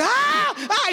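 chillido agudísimo imitado; grito de susto. Retold or imitated. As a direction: gritar contagiado por otros, exagerando. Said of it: "ellos empezaron a gritar". (grito agudo de susto fingido)

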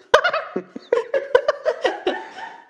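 long high burst of laughter; delighted laugh. Real, not acted. Reacts to the slime setting.

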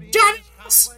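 high, loud shrieked insult; Kitty's exasperated "Dumbass!". Retold or imitated. Doing Kitty scolding Red from That '70s Show, shrill mock yell. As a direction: fling it at Red like a fed-up sitcom mom. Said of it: "she just looks at Red and just goes".

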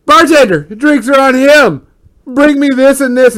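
high, loud bar order; calling out. Acted. Lottie calling to the bartender, boisterous shout. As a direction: holler an order across the room.

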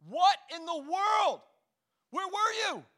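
loud, slow, high disbelieving yell; exasperated shout. Retold or imitated. Coach yelling at player.

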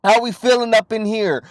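high, loud New York street greeting; New York accent, loud and high. Retold or imitated. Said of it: "anytime that you talk about New York".

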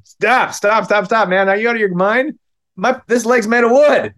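halting his friend before the staple gun fires; urgent stop. Retold or imitated. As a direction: stop him before he pulls the trigger. Stopping the kid from stapling.

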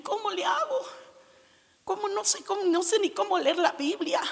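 high, pleading prayer with a catch of tears; tearful pleading. Retold or imitated. New convert praying to God, high tearful plea. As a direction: beg God like a lost new believer. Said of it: "Y ahí llorando".

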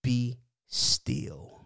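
slow, separated words with a long pause between; measured divine command. Retold or imitated. Preacher quoting the voice of God, slow and measured. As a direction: let each word land calmly and deliberately.

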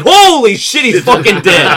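stunned bridge crew. Retold or imitated. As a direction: yell it like the whole bridge just lost it. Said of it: "And everyone's like".

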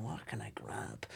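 hushed whisper, no voice. Retold or imitated. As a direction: whisper it to yourself, slowly.

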